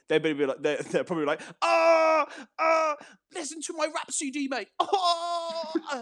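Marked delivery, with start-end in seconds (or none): 1.6-6.0 hammed-up high dying wail; dying chav. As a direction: wail it very high, mock death cries. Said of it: "while they're dying".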